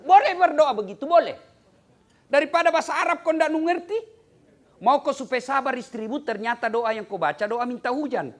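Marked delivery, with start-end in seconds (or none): 0.0-5.1 emphatic loud. Real, not acted. Preacher stressing a point loudly.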